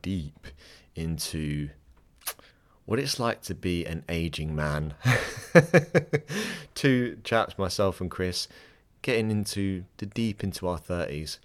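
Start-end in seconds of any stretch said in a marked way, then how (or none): 5.0-6.7 a high, loud laugh breaking out; sudden loud high laugh; loud burst of laughter. Real, not acted. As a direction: laugh out loud at your own joke. Laughing at aging man remark.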